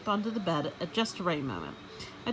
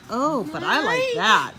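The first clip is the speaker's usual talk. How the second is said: sudden delight; 9 semitones higher, 7 dB louder than usual. Acted. Gail suddenly sees golden sample.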